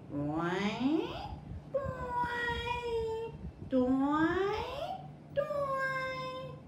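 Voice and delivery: high, soft twanging toing; imitated toing noise. A narrator's reading. Storyteller imitating the stick's sound in the forest. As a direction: make the stick's springy twang. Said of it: "She heard sounds like".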